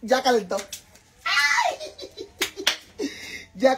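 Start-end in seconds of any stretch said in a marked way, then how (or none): 1.2-1.8 very high squeal of delight. Real, not acted. The comal is hot, a high squeal of joy. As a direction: yelp with joy.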